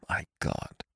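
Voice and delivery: breathless whispered horror; horrified whisper. A narrator's reading. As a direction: whisper it in shock.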